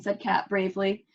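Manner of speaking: ordinary delivery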